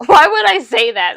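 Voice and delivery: loud, high, embarrassed outburst; mock mortification. Nan Young regretting her gushing, loud mock mortification. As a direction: cry it out as if kicking yourself. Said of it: "she embarrassed herself".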